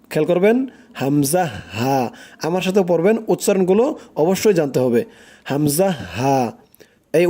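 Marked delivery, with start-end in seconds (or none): 1.0-2.0 হামজা, হা said low and firm from the throat; Arabic makhraj drill; firm Arabic letters. Shown as a demonstration. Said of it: "শক্ত করে". 5.5-6.4 হামজা, হা said low and firm from the throat; Arabic makhraj drill; firm Arabic letters. Shown as a demonstration. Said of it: "শক্ত করে".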